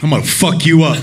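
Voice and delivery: low-pitched threat, acting out an angry friend; mock menace. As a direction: say it low, like someone who just snapped. Friend snaps and threatens woman.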